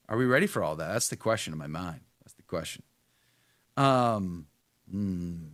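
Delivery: usual speaking voice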